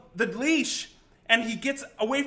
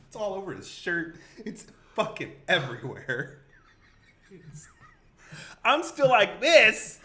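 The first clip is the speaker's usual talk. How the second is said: usual speaking voice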